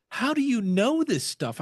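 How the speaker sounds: high, loud, incredulous question; amazed friend. Retold or imitated. Dinner companion amazed at his music trivia, incredulous. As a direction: marvel at how much he knows. Woman quizzed on [name].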